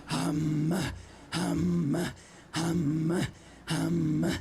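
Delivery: very low monster "Hum"; each "hum" dropped deep into the throat. Shown as a demonstration. Throat singer demonstrates the syllable to the audience, monster voice. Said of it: "use your monster voice".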